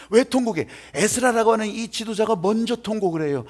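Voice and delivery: ordinary talk, nothing marked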